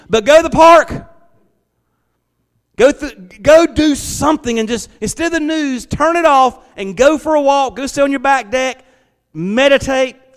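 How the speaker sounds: high, loud preacher's exhortation; full-force preaching. Acted. Pastor urging church to turn off the news, loud preaching. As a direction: urge the church to switch off.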